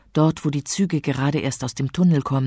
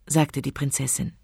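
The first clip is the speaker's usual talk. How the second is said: usual speaking voice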